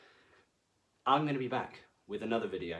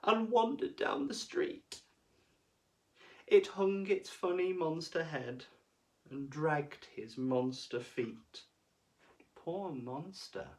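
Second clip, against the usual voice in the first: sad pity; 5 semitones higher than usual. A narrator's reading. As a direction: feel sorry for the monster. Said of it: "sad monster walking away".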